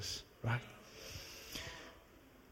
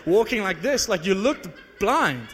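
louder, higher, baffled rant at the man; mock indignant shout, higher and louder; exasperated outburst; 5 semitones higher, 5 dB louder than usual. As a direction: let it burst out in disbelief. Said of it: "I wanted to say".

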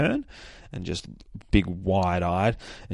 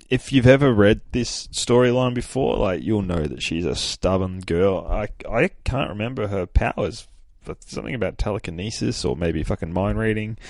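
ordinary delivery

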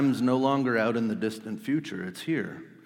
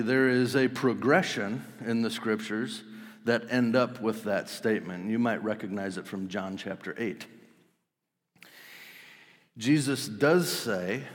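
ordinary delivery

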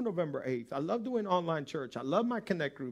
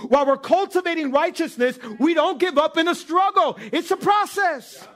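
high, loud, emphatic sermon delivery; preaching at full force; 11 semitones higher, 9 dB louder than usual. Acted. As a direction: drive the point home to the congregation.

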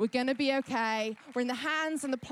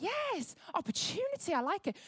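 much higher gleeful cheer; excited whoop; 9 semitones higher than usual. Retold or imitated. Herself thrilled by the lost-bags crisis, a high excited whoop. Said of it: "'Cause I'm like".

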